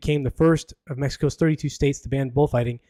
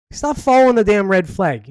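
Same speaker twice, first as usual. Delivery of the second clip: louder, exasperated telling-off; mock scolding; 6 semitones higher, 7 dB louder than usual. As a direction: yell at the bulls like a frustrated coach. Scolding the bulls.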